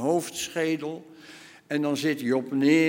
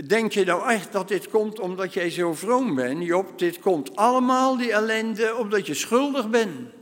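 higher, louder accusation; accusing; 6 semitones higher, 4 dB louder than usual. Acted. Friends accusing Job of being guilty, rising anger. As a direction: hammer home that he is guilty.

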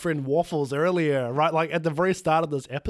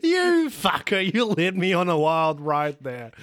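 mock outrage; 3 dB louder, slower than usual. Mock outrage after the joke.

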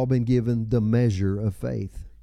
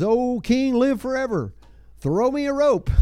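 high-pitched comic call from below; acted call up out of a pit; calling back; 12 semitones higher than usual. Daniel answers the king from the den, calling back high.

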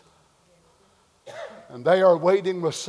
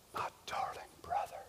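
hushed, tender whisper; whispered greeting; 21 dB quieter than usual. Retold or imitated. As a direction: whisper it as to a loved one. Wife greets him in heaven.

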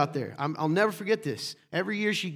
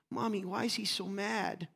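put-on small, higher child voice; lightly imitated little girl, higher and softer; 4 semitones higher, 6 dB quieter than usual. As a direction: ask it softly like a puzzled little girl. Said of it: "cutest little girl in the world".

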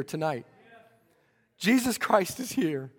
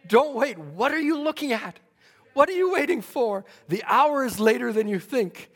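fiery preaching; 4 semitones higher than usual. Acted. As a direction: drive the congregation to act now. Preaching at full force.

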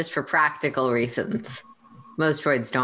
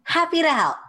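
high, bright, chirpy helper; cheerful droid voice; 14 semitones higher than usual. Acted. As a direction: chirp it out happily. Kitty cheerful in character.

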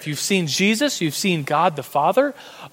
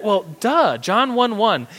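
obvious-answer duh; 5 semitones higher, slower than usual. Acted. Answers the imagined doubter.

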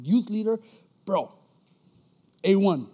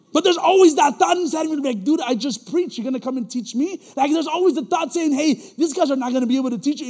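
loud, high fervent sermon delivery, louder and higher; preaching; 5 semitones higher, 7 dB louder than usual. Acted. Preacher exhorting the church about being formed, loud. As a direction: drive it home like a pastor building up.

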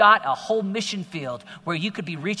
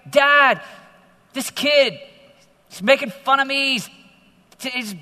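put-on loud, higher complaint of a bullied boy; upset kid to dad; 8 semitones higher, 9 dB louder, slower than usual. Preacher as eighth grader complaining to dad, loud and high. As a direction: cry out to dad like a hurt kid. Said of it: "I came home eighth grade and said".

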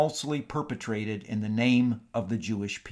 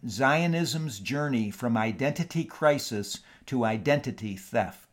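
usual speaking voice

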